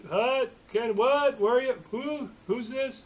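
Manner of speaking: put-on high, loud, bewildered old-timer shouting into the phone; confused old man on phone. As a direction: yell down the line like a puzzled old man who can't hear. Old friend answering a phone call.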